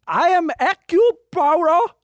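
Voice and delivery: put-on high, loud French detective accent. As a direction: announce it like a grand Belgian sleuth. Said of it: "he says it all fucked up".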